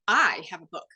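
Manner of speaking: "I" hit hard, the rest falling away; stress on I. Shown as a demonstration. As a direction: punch the word "I" at the start. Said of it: "inflection matters where it's like".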